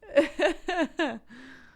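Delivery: long, loud, high laughter; real laugh that bursts out. Real, not acted. Mother laughs at own story.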